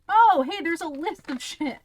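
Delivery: excited cry. Real, not acted. Spots ingredient list, excited.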